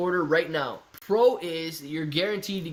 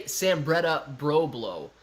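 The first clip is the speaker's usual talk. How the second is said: "Sambretta Broblo" said slowly, word by word; slow, careful version; much slower than usual. Shown as a demonstration. Showing chat how not to say it, slow and careful. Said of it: "try to say it really fast. Don't say".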